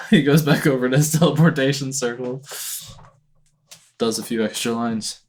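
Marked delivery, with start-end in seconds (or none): none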